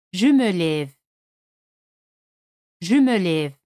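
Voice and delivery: "je me lève" said clearly with a mute final e; French model. Shown as a demonstration. Teacher models "je me lève" for learners. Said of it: "teacher models answer".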